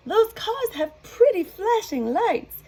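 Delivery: very high, sweet cow voice; delighted cow. Acted. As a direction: marvel at the lights like a child. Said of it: "said Molly".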